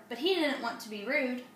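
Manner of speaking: ordinary delivery, nothing marked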